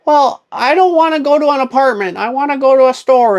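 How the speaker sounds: put-on high-pitched, loud old lady objection; hammed-up stubborn old woman. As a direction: protest in a put-on granny falsetto. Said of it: "I'm gonna do my old lady voice".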